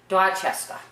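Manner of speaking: DORCHESTER as "Dah-ches-tah". Shown as a demonstration. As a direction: say DORCHESTER without any r's. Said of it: "No R's".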